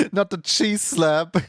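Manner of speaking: slow words through laughter; giggling delight; laughing. Real, not acted. Laughing at emote.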